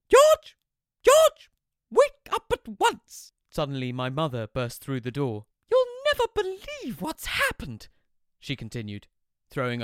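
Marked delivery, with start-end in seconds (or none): 0.1-3.3 very high, loud mother calling; loud shrill mother. Acted. Mother bursting in to wake her son, loud and high. 5.7-7.9 high, loud mother gushing news; excited mother. Acted. Mother bursting in with news, very high and loud.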